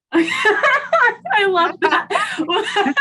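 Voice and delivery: high, slow laughing, near tears. Real, not acted. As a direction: laugh it out with delight. Laughs after the poem.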